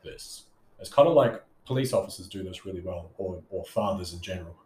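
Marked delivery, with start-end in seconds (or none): 0.0-0.4 "this." dropped low and much softer at the end; downward inflection. Shown as a demonstration. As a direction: end the word "this." falling firmly down. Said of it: "if you talk like".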